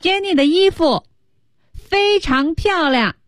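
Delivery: high, slowly stretched declaration; loud announcement. Acted. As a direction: announce it as if into a microphone. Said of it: "大声地对着麦克风说".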